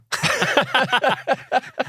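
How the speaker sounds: high burst of laughter; hearty laugh. Real, not acted. Laughing at own joke.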